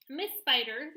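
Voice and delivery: usual speaking voice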